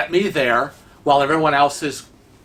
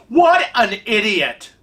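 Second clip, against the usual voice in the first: slow, higher-pitched mock exasperation; exasperated outburst; 5 semitones higher, slower than usual. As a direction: imitate someone fed up, drawing the words out. Acting out Kevin's exasperation.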